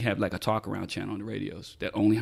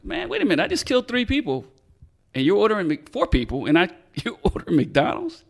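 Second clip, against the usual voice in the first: high, stammering incredulous imitation; mock disbelief; 8 semitones higher, 4 dB louder than usual. Voicing suspect's look at detective ordering McDonald's, incredulous. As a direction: start very high, baffled, breaking into a laugh. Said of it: "That's the look he had on his face".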